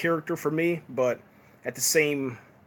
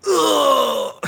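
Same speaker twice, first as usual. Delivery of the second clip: a much higher, louder imitated pained grunt; mock pained grunt; 13 semitones higher, 7 dB louder than usual. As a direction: act out being blinded by dust with a short grunt. Luke Cage hit by dust.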